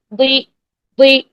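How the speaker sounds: دوئی as a heavy ضی, tongue sides back; heavy ضاد with kasra. Shown as a demonstration. Teacher drills kasra for students, heavy ضی. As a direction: hold دوئی heavy into a long "ee". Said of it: "کسرہ کے ساتھ practice".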